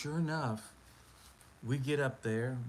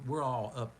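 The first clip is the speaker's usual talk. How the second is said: normal talking voice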